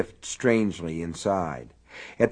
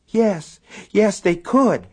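high, loud anguished agreement; distraught; 11 semitones higher, 6 dB louder, slower than usual. A narrator's reading. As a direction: blurt it in anguish.

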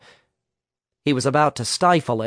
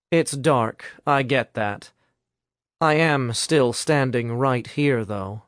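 ordinary delivery, nothing marked

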